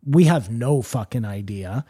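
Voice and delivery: ordinary talk, nothing marked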